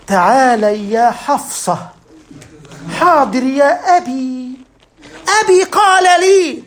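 mocking a showy religious household; mock pious formal talk. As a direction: drawl it like a prim pious family. Father and daughter, mock formal exchange.

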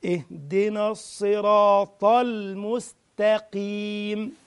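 slow, drawn-out tajweed chant; Quran recitation. Shown as a demonstration. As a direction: recite it slowly, letting each vowel ring.

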